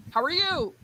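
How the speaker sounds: high-pitched call into the distance; calling for an echo. Acted. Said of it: "the Echo scene".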